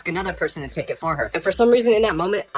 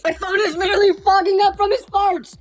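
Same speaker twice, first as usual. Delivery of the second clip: high, exasperated comic wail; hammed-up high-pitched disgust; disgusted rant; 13 semitones higher than usual. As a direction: complain at the top of your range. Disgusted rant about dog farts.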